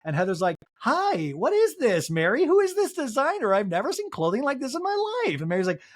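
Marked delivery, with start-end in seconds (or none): 0.8-5.4 very high mock gushing; hammed-up high-pitched awe; gushing amazement. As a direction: squeal over the outfit, imitating. Heather gushing over an outfit.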